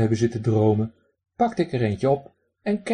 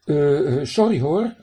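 flustered stammered apology, louder and higher; stammering; 4 semitones higher, 5 dB louder than usual. A narrator's reading. Man stammers an apology to the talking duck, flustered. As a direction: trip over the words in surprise. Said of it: "een hakkelend".

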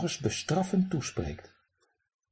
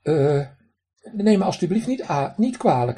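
stammering; 6 semitones higher, 3 dB louder than usual. A narrator's reading. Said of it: "stamelde ik".

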